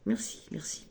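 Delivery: whispered merci, merci; whispered thanks. Real, not acted. Psychic whispers thanks to her guides, whispered. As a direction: breathe a quick thanks under your breath. Thanking the cards mid-reading.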